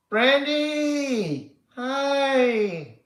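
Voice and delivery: high, loud happy shout; excited greeting. Real, not acted. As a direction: call out a delighted hello. Greeting arriving viewer.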